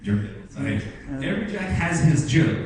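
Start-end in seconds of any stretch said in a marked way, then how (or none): 1.2-2.5 slow emphatic proverb. Shown as a demonstration. Teacher quotes English proverb.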